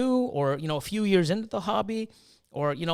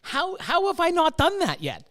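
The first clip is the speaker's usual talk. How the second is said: high, incredulous self-questioning; pitched-up amazed how-did-I-miss-it; amazed disbelief; 7 semitones higher, 3 dB louder than usual. Retold or imitated. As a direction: kick yourself in astonishment. Amazed at own oversight.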